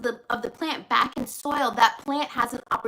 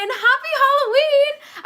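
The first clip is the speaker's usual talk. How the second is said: high, loud, drawn-out Halloween cheer; loud high slow holiday cheer; excited shout; 13 semitones higher, 8 dB louder than usual. Real, not acted. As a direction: yell it out with glee. Shouted greeting to viewers.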